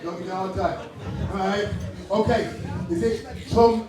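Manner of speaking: ordinary talk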